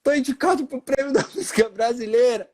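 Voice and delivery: high, tearful cry-talk; choked up with joy, voice pitched high; crying to mom. Retold or imitated. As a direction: tell mom the news through tears.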